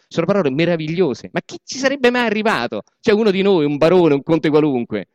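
loud, mock-admiring speech to the comrades; sarcastic ruling-class boss. Aristocrat mocking left-wing comrades, loud sarcastic praise. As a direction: gush with fake praise, dripping irony.

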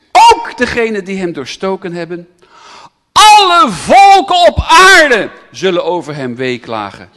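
loud, rising proclamation of the verse; preacher booming Revelation to the crowd; shouted scripture. Acted. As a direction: thunder the verse out.

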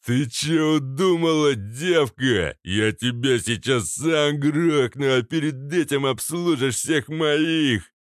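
loud furious roar. A narrator's reading. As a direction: bellow it in rage. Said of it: "заревел".

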